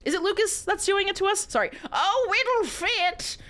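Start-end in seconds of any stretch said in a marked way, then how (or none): 1.9-3.4 slow drawn-out husky line, high; a hammed-up husky come-on. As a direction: breathe it out huskily, stretched. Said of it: "Lucas said huskily".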